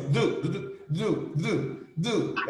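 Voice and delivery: fast-talking friend. Retold or imitated. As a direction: imitate rapid speech as "du, du, du". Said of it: "é um negócio assim".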